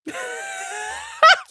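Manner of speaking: high, squealed laugh; real high wheezing laugh. Real, not acted. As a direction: dissolve into laughter. Cracks up at own accent.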